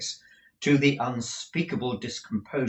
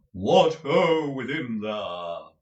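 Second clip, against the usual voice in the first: deep voice; 3 semitones higher, 7 dB louder, much slower than usual. A narrator's reading. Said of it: "said Mister Lenville in a deep voice".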